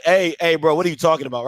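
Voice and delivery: low, disbelieving pushback; low-pitched calling-out; confrontational challenge. Shown as a demonstration. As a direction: question him in a low, serious voice. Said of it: "what did you just say".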